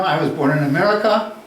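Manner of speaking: ordinary talk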